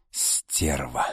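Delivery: low, soft exhaled curse; breathed out. A narrator's reading. Alexei realises who betrayed him, breathing it out. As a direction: let the curse out under the breath. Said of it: "выдохнул Алексей".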